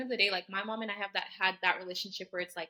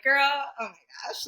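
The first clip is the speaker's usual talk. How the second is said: loud, slow, higher-pitched exclamation; overwhelmed reaction; 5 semitones higher, 7 dB louder, slower than usual. Retold or imitated. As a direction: draw it out loudly in disbelief. Reacting to tearful mother, retold.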